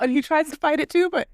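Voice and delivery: usual speaking voice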